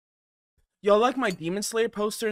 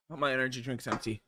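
ordinary talk, nothing marked

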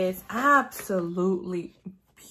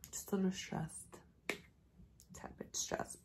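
soft whisper, barely voiced; whispered reply; 15 dB quieter than usual. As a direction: breathe it out like an embarrassed confession. Quiet reply to worried family.

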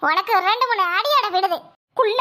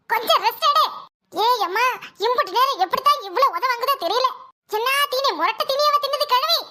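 usual speaking voice

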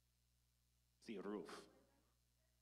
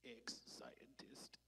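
slow mournful whisper; hushed and drawn out; whispered title; 9 dB quieter, much slower than usual. Acted. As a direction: breathe it out like a confession. Sad title whispered after pause.

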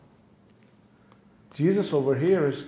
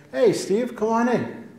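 higher, cheery "Hey, Steve, come on in"; friendly president; 6 semitones higher than usual. Retold or imitated. President greeting Steve at the door, cheery and higher. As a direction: call out like an old friend, higher.

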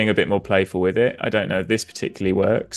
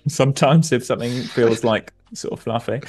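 usual speaking voice

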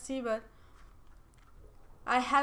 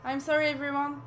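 normal talking voice

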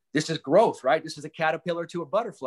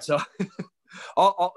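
laughing; 5 semitones higher, 3 dB louder than usual. Real, not acted. Laughs mid sentence.

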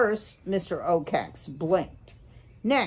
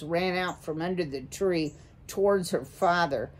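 ordinary delivery

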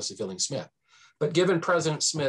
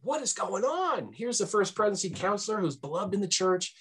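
mock bewilderment; 7 semitones higher than usual. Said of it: "Mormons are".

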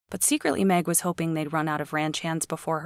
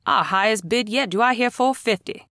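higher, louder exclaiming auctioneer; excited auctioneer; 5 semitones higher, 5 dB louder than usual. Acted. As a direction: pump the crowd for more. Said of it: "Billy exclaimed".

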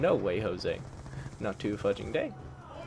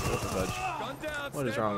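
baffled; 3 semitones higher, 3 dB quieter, slower than usual. Real, not acted. Sudden confusion at weapon.

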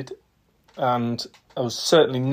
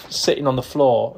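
ordinary delivery

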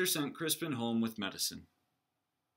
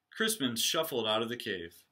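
ordinary delivery

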